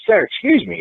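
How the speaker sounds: high insistent "Sir, excuse me"; firmer interruption. Retold or imitated. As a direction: demand to be heard, politely. Interrupting again.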